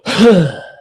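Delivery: loud open-mouthed sigh, letting all the air out; long exhale sigh. Shown as a demonstration. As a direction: demonstrate a full release of breath. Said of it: "you open your mouth and you go".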